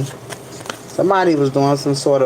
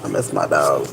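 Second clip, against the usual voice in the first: real sadness; 11 semitones lower than usual. Real, not acted. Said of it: "Why am I emotional?".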